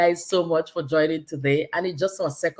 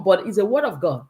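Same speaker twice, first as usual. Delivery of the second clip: ordinary talk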